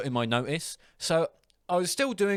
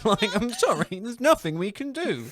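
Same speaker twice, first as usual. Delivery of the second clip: high, amused imitated refusal; retold laughing apology; 10 semitones higher, 4 dB louder than usual. Clerk claims to be in charge, high giggly apology. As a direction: barely hold back the laughter. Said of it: "I start laughing down the phone".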